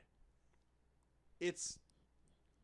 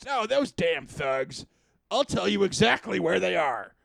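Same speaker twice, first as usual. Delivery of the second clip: grumbling local voice; 3 semitones higher, 12 dB louder than usual. Retold or imitated. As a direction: grumble about the thugs like a fed-up villager. NPC points to thugs.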